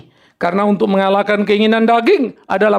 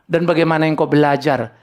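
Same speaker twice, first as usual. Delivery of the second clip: ordinary delivery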